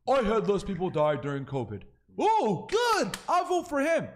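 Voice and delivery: very high, loud dumb voter voice; mock normie voter. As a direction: ham up a gullible voter being won over. Mocking normie voter reaction.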